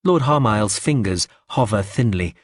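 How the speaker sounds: ordinary delivery, nothing marked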